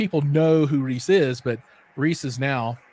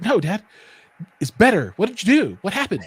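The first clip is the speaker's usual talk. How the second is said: put-on excited kid; 5 semitones higher, 5 dB louder than usual. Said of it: "He's like".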